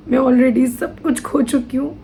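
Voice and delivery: choked with grief, long pauses between phrases; real tearful breakdown. Real, not acted. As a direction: push the words out through tears, stopping often. Breaking down over her loss.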